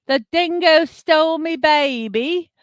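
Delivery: high, loud bad Australian accent; mock Australian movie quote; horrible Australian accent. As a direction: ham up the Australian film line. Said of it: "I did a horrible accent".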